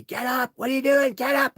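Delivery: retold yell, high and loud; dad yelling. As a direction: shout it up high like an alarmed dad. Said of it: "He was yelling at me".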